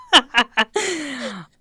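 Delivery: teary helpless laughter, voice cracking high; laughing while crying. Real, not acted. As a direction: laugh through tears at the card. Breaks down reading card.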